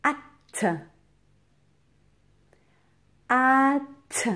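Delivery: short "Hâte" with a sharply released t; "Hâte" sounded out high, the t set apart; isolated first syllable. Shown as a demonstration. Teacher isolating the first syllable for pupils, sounded out clearly. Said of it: "la première syllabe du mot".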